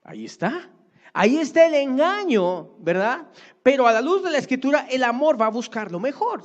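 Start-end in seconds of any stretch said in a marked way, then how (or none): 1.1-6.4 high, loud preacher's cry; preaching shout. Real, not acted. Preacher crying out to the congregation, high and loud. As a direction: drive the point home from the pulpit. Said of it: "preaching point at full force".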